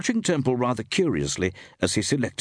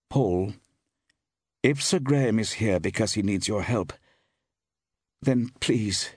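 mildly worried plea; catch in the voice; 3 semitones higher than usual. A narrator's reading. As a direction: plead with a slight catch in the voice. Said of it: "There was a catch in her voice".

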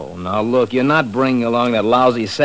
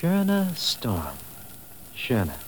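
very slow, softer, drawn out; savoring the name; 9 dB quieter, much slower than usual. Shown as a demonstration. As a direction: present the name like a prize. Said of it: "second great discovery".

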